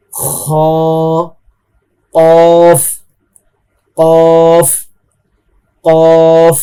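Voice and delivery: each heavy letter said thick and loud, held. Shown as a demonstration. Teacher drills heavy letters for students to repeat. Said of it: "মোটা হরফ কিভাবে উচ্চারণ হয়?".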